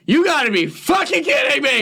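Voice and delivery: high-pitched outraged yell; hammed-up furious disbelief, voice shooting up; re-enacted anger. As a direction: act out an incredulous, pissed-off outburst. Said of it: "Oh, I was pissed. I was mad".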